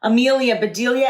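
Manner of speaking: ordinary delivery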